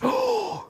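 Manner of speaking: high intake of breath; mock frightened gasp. Reacting to the troll's order, mock frightened gasp. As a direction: gasp in pretend alarm.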